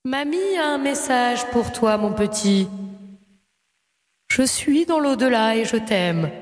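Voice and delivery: slow echoing ghostly grandma. Acted. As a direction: linger on each word from beyond. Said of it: "la voix de fantôme".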